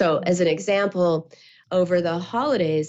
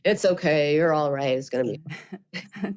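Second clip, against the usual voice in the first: dismissive reassurance; 4 semitones lower than usual. Shown as a demonstration. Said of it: "Can you imagine saying that".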